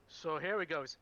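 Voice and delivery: normal talking voice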